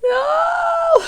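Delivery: high, loud mock wail of despair; anguished cry. Hearing her favourite cream is discontinued, loud wail. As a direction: cry it out like heartbreak, hammed up. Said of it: "And I just went".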